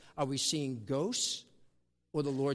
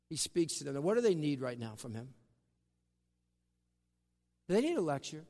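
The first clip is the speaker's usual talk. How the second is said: ordinary talk, nothing marked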